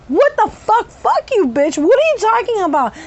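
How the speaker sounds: high-pitched angry retort; reliving a furious outburst, pitched high; outraged cursing. Retold or imitated. Patient cursing at her psychiatrist, outraged.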